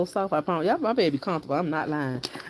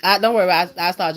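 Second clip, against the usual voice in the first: louder, calling across the house; 4 semitones higher, 9 dB louder than usual. Real, not acted. Mother calling to her child that she'll start the water, called out.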